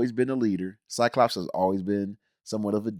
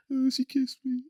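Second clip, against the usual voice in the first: high, soft sulky whimper; mock sulky Wolverine; 13 semitones higher, 5 dB quieter than usual. Wolverine sulking off after Jean's kiss, high soft whimper. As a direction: sulk it out like a lovesick loner. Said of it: "he sulks away like".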